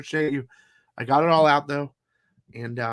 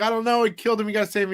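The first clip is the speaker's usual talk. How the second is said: panicked butler; 7 semitones higher, much faster than usual. Retold or imitated. Butler voiced in retold story.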